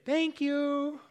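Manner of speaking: high, light imitation of wife calling back; wife's reply. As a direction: lightly voice the wife answering from upstairs. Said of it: "And she'll say".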